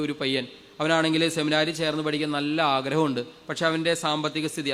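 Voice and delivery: ordinary talk, nothing marked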